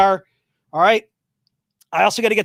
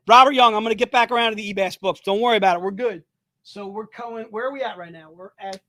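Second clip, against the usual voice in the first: normal talking voice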